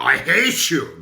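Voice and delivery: "I hate you" said loud and punchy; emphatic. Shown as a demonstration. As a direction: throw "hate" out hard, with a gesture. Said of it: "when we talk with our hands".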